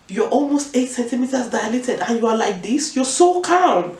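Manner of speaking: amazed, high and loud, stretching the last words; astonished consultant. Retold or imitated. Consultant checks the calm labouring patient, astonished. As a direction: voice climbing in disbelief.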